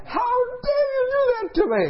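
very high-pitched screech of outrage; imitated angry yelling. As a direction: shriek it like a livid, wounded woman. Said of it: "She's yelling at me".

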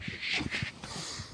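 long, soft repeated shush; mom's shushing. Acted. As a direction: hush an embarrassing child quickly. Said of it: "And your mom's like".

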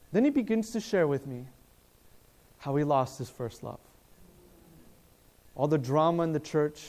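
usual speaking voice

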